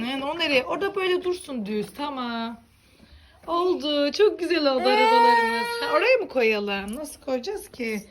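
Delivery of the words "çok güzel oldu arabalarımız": loud, high delighted exclaim; excited cry. Real, not acted. Vlogger shows viewers the finished cars, cheering loudly. Proudly finishing the car stack.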